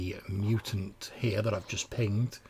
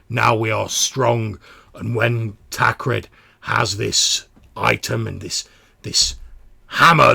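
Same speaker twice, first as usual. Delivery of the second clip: forceful, deliberate justification; commanding mutant leader; 2 semitones higher, 11 dB louder than usual. Acted. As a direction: declaim it like a zealous tribe leader. Voices Ulva justifying the choice.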